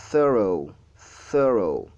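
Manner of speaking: "thorough" with airy TH and falling end; deliberate "thorough", tongue between the teeth; model pronunciation. Shown as a demonstration. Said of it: "on place le, la langue entre les dents".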